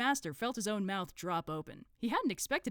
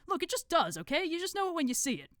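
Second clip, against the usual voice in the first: higher, louder exasperated retort; 12 semitones higher, 6 dB louder than usual. Acted. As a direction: shut the objection down impatiently. Watchtower cuts off the grumbler.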